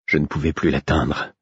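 usual speaking voice

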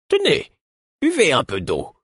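high-pitched character voice; voice of Caudron. A narrator's reading. Woman offering water to an exhausted traveller, high character voice. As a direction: pitch it well up as another speaker. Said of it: "C'était la voix de Caudron".

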